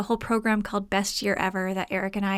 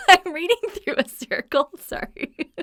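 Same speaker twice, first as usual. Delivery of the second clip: high, helpless laughter through the words; real laughing fit; 10 semitones higher, 7 dB louder than usual. Real, not acted. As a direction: break up laughing, then squeak out an apology. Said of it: "I can't stop laughing".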